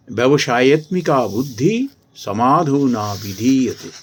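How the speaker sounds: loud, slow scripture recital; solemn Gita shloka recitation, projected; Sanskrit verse recitation. Acted. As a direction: recite the shloka loudly, drawing out the words.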